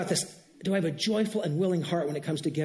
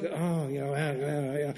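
mock hemming and hawing; 6 semitones lower, 4 dB quieter, slower than usual. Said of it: "instead of just kinda doing".

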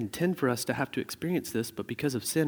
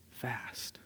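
dramatic whisper; 16 dB quieter than usual. Acted. Pastor to congregation, whispering how fast life goes. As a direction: breathe the last word out in a whisper.

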